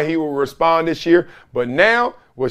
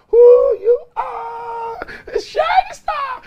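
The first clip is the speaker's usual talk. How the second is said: very high, drawn-out announcer call; announcer hype; slower than usual. Acted. Host announcing the giveaway winner, hyped high call. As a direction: belt the winner's name like a game show. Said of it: "I'm about to announce".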